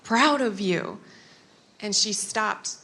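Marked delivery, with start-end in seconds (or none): 0.0-1.0 slow, high, tender I am so proud of you; tearful pride. Retold or imitated. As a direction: hold each word, choked with pride. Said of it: "I, like, start crying".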